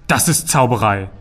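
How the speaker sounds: mildly astounded outcry; amazed exclamation. A narrator's reading. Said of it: "rief Ander verblüfft".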